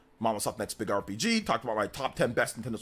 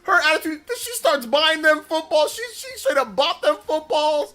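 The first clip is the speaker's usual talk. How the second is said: high, loud words broken by laughter; can barely get it out for laughing; giddy, laughing retelling; laughing through it; 12 semitones higher, 7 dB louder than usual. Real, not acted. Laughing while telling sister story.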